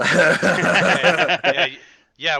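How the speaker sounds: loud laughing through words. Real, not acted. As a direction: laugh while talking.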